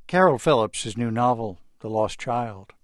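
normal talking voice

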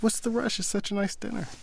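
imitating the fiancée asking why he's rushing; puzzled fiancée. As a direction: lightly voice her puzzled protest.

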